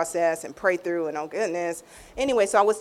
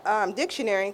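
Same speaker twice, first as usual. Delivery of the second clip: ordinary talk, nothing marked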